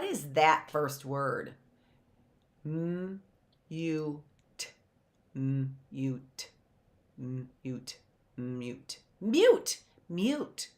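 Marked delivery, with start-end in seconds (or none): 2.6-10.7 low, soft "Mute" in separate sounds; quiet piece-by-piece "Mute"; sounding out. Shown as a demonstration. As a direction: sound out M, U, T, gradually closing the gaps. Teacher sounding out, then blending.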